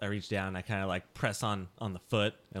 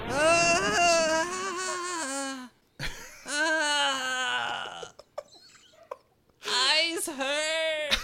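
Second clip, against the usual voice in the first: loud, higher drawn-out moans; pained dopey wail; 7 semitones higher, 5 dB louder, much slower than usual. Acted. Drugged Skylar blinded by the flash, loud dopey wailing. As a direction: wail it slowly like a dazed simpleton.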